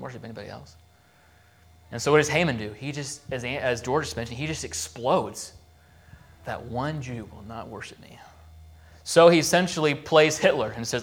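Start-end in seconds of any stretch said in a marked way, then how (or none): 6.4-8.2 hissed whisper of a furious official; intense whisper. Retold or imitated. Preacher voicing enraged Haman to congregation, whispered. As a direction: whisper the threat through clenched rage. Haman explodes in retold story.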